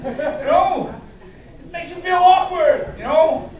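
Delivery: ordinary talk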